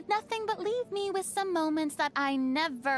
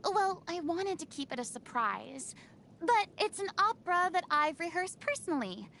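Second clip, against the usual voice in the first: ordinary delivery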